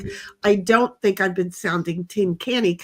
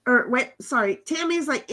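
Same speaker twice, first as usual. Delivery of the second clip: ordinary talk, nothing marked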